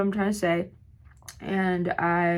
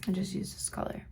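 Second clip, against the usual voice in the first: soft unvoiced whisper; whispered aside; 10 dB quieter than usual. Real, not acted. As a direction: whisper it to the viewers while working. Quiet makeup aside.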